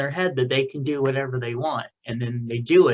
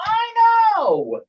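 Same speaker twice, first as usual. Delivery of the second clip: excited agreement; 7 dB louder than usual. Real, not acted. Gushing agreement about a birthday.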